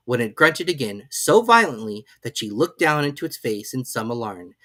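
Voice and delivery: plain reading voice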